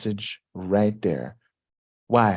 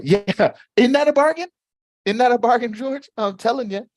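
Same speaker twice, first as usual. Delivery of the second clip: excited; 13 semitones higher, 5 dB louder than usual. Acted. Mock excited host bit.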